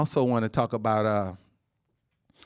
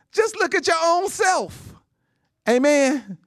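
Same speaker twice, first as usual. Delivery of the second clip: rousing preaching; 8 semitones higher than usual. Acted. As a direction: make them marvel at God's work. Preacher marvels at the body.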